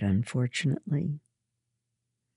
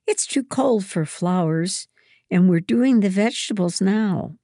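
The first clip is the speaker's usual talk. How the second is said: higher, raised-voice retort; snappish; 5 semitones higher, 5 dB louder than usual. A narrator's reading. Lydia dismisses Mary's guess, snappish. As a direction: argue back with mild irritation. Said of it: "Lydia snapped".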